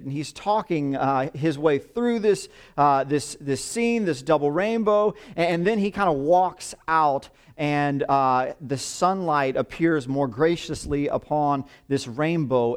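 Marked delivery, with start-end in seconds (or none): none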